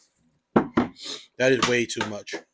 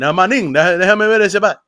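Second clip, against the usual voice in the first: put-on Dominican Spanish accent, quick and loud; imitating a Dominican player in Spanish; 5 semitones higher, 8 dB louder, faster than usual. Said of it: "your Indian Spanish accent".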